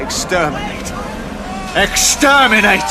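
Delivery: grating Dalek voice building to a loud shout; Dalek battle cry. Acted. Dalek builds from cold threat to a shouted battle cry. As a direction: start cold, end screaming Exterminate.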